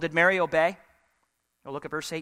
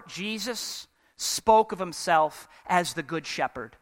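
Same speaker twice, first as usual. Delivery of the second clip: usual speaking voice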